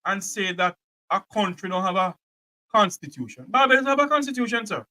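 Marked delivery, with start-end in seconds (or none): none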